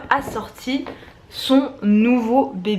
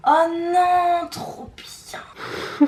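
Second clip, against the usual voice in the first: excited; 8 semitones higher, much slower than usual. Real, not acted. Opening another gift.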